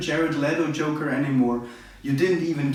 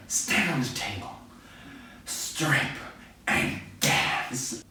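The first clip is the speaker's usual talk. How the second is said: menacing quiet order; 6 dB quieter, slower than usual. Retold or imitated. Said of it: "And he's like".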